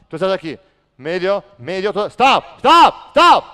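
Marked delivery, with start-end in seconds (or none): 2.1-3.4 high, very loud stop shout; furious shout. Real, not acted. Coach stopping the drill, a high furious shout. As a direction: stop everything with a big shout.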